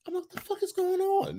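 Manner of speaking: high, soft squeak of bewilderment; mock-tearful disbelief. Co-host retells tearing up at Heels, mock-weepy squeak. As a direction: squeak it as if fighting back tears. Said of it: "why am I crying".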